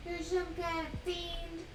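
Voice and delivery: slow, high, stretched cooing. Real, not acted. Mother cooing slowly at her dog, stretched baby talk. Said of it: "I'm in baby talk mode".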